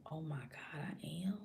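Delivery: near-whispered acted realization; hushed shock. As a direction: let it sink in under your breath. Retold reaction to pregnancy news.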